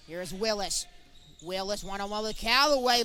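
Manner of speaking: ordinary talk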